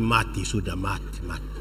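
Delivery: hushed, low, fading to almost nothing; very soft, low inner muttering. Acted. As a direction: think it aloud under your breath. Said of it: "Dia dalam hati".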